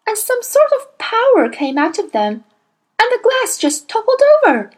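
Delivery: put-on high, eager child's voice telling a wonder; a girl rushing to tell her secret; little girl Matilda, excited. A narrator's reading. Matilda's lines in the story.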